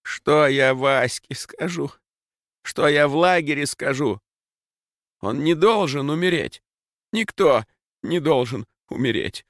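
talking against burning pain; anguished. Acted. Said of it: "заговаривал сжигающую изнутри боль".

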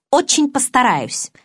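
high, slow, haughty retort. A narrator's reading. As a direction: draw it out with lofty disdain. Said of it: "надменно проговорила я". (надменно, с растяжкой)

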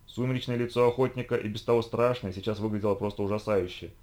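ordinary delivery

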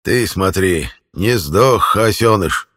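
put-on jeering crotchety old villager; creaky old man voice. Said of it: "скрипучий старческий голос".

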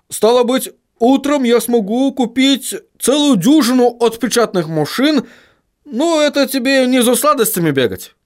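high-pitched dwarf character voice; dwarf haggling over machines. Acted. Dwarf tells William buying a press is no quick errand, high-voiced objection. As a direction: talk high as a reasoning dwarf.

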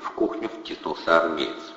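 usual speaking voice

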